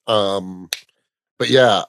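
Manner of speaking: ordinary delivery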